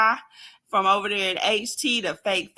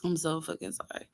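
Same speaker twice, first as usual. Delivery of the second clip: calming down; 8 dB quieter, faster than usual. Real, not acted. Vlogger apologizing to viewers, quietly calming down. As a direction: settle down and murmur the apology. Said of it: "I gotta calm down".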